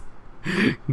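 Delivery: high airy laugh; soft amused laugh; breathy laugh. Real, not acted. Listener laughs again.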